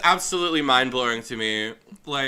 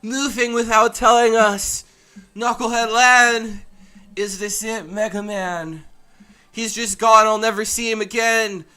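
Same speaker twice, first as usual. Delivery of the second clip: put-on higher, louder panicked boyish cries; desperate boy hero; 7 semitones higher, 6 dB louder, slower than usual. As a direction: chase after a friend who's leaving, voice climbing. Reads Lan's panicked game lines.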